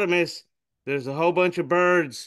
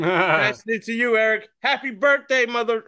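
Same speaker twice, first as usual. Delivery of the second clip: cheery shout-outs; 9 semitones higher, 5 dB louder than usual. Real, not acted.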